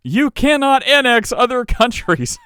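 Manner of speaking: high, slow, declaimed protest; mock indignant proclamation. Host reads prime ministers' statement to Trump, mock indignant.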